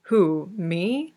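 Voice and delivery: innocent question with a rising lilt; mock innocence. A narrator's reading. Victor answering a jealousy jibe, all innocence. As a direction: play it wide-eyed and blameless. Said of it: "blue eyes all wide in perfect innocence".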